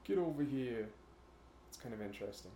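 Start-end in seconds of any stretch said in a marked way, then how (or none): 0.0-0.9 put-on East Coast accent. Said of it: "how it went from that to".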